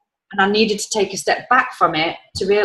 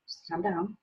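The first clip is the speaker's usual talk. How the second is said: much softer, hushed "Just calm down" to herself; calming self-talk; 12 dB quieter, slower than usual. Retold or imitated. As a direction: soothe yourself under your breath. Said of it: "And then I was like".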